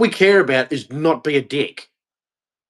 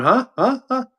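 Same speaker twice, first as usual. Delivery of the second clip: confused natives mimicked; 9 semitones higher than usual. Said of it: "they would have been going".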